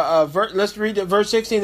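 usual speaking voice

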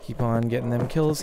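ordinary delivery

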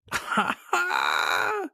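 high, drawn-out aha of discovery; high gleeful cry; delighted a-ha. A narrator's reading. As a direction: burst out as the idea strikes. Mask hits on an idea.

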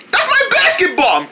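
high excited cry; excited shout. Acted. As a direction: claim the ball excitedly. Shouting on recognizing ball.

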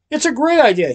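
high, loud insistence; emphatic correction. A narrator's reading. Willie correcting Noodle about his idea. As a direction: correct her with gusto. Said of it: "he corrected her".

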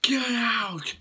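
high, sharp mock yell; angry shout. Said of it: "this Clark is really angry".

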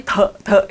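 "เถอะเถอะ" as full, voiced syllables; over-voiced t sound. Shown as a demonstration. Teacher showing students what to avoid, over-voiced t sound. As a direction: overdo "เถอะเถอะ" with a whole vowel after each t. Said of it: "ก็ไม่เถอะเถอะขนาดนี้".

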